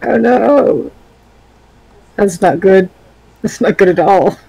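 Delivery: hammed-up dismay at a bad turn; mock alarm. Player told the vines have trapped their character, mock alarm. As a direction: lament it with playful alarm.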